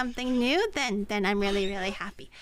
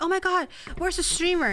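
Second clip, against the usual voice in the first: mock panicked viewer; 4 semitones higher than usual. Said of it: "go like".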